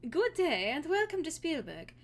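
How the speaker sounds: high, bright-voiced greeting from the market stall; a lightly voiced friendly seller; cheerful farm girl. Farm girl welcomes a customer to Spielberg: high and friendly.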